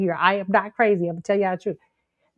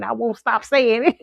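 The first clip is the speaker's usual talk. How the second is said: ordinary talk, nothing marked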